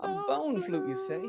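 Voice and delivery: lightly voiced lizard man, opening high. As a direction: start high, then settle, as the lizard man. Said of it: "the lizard man will notice you".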